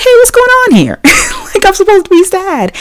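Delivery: very high-pitched playful complaint; mock indignant protest. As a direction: protest in a squeaky, hammed-up voice, half laughing. Mock protest at being cheered up.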